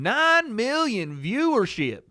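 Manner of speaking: high, slow, self-important dad impression; dad talking technical. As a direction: stretch NINE MILLION VIEWERSHIP out high and grand. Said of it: "Trying to talk in, like, technical terms".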